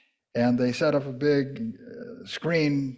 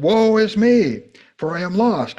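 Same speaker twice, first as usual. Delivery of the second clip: dramatic reading; 6 semitones higher, 7 dB louder than usual. Acted. Reading Isaiah's cry aloud.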